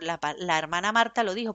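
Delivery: usual speaking voice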